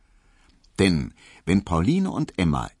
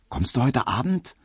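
ordinary delivery, nothing marked

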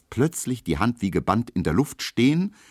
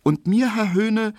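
eager maid; 5 dB louder than usual. A narrator's reading. Hedwig starting her order.

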